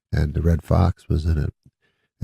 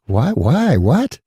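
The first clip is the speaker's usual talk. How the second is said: shocked disbelief; 7 semitones higher, 3 dB louder, much slower than usual. Retold or imitated. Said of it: "I'm like".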